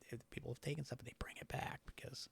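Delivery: hushed ghost-story whisper; unvoiced spooky whisper. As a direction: lean in and whisper it. Spooky superstition told hushed.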